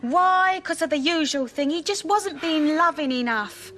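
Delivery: loud, high, fed-up complaint; exasperated outburst. Acted. Angie venting to her friend about her husband, loud and exasperated. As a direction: throw the words up in exasperation.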